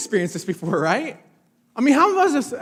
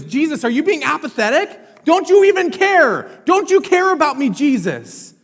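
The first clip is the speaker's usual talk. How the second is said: higher and louder, a pleading outcry; desperate cry; 8 semitones higher, 6 dB louder than usual. Retold or imitated. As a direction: plead as if Jesus doesn't care. Disciples crying out to Jesus.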